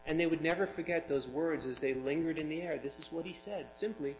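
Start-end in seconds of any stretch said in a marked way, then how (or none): none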